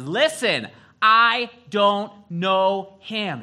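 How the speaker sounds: loud, high, drawn-out acted shout; angry denial. Cornered Peter swearing to the crowd, an angry acted shout. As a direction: shout it slowly, word by word, in anger. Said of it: "he starts getting angry".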